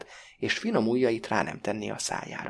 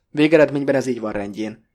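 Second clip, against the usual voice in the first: louder, mildly patronising good cheer; jovial condescension; 7 dB louder than usual. A narrator's reading. Blavatsky declares it all in order, jovially condescending. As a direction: condescend genially, with a hollow ring. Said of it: "mondja kedélyes leereszkedéssel".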